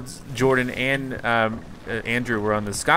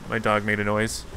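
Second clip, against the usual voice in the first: usual speaking voice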